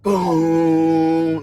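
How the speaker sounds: long droning boom held on one note; imitated boom. Storyteller showing what the noise was doing, imitated boom. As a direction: hold out a resonant boom like a sound effect. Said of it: "Like that. That's what it's doing".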